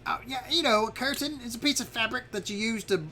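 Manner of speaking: high-pitched helpful little dragon; chatty high creature voice; Zarf, explaining. Acted. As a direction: explain the obvious in a high character voice. Game master plays Zarf explaining.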